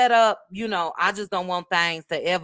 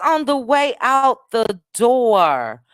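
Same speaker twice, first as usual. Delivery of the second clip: drawn-out announcement; 2 semitones higher, 6 dB louder, slower than usual. Real, not acted. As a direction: stretch it out to DOOR. Drawn-out sign-off announcement, then repeated.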